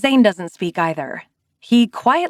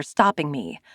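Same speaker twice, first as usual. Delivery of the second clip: ordinary delivery, nothing marked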